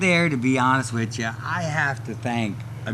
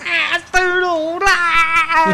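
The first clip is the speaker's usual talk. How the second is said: much higher, slow, drawn-out mock cheer; mock delighted cry; 6 dB louder, much slower than usual. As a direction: stretch it out like a thrilled wrestler recalling a joke. Wrestlers reacting to Stone Old.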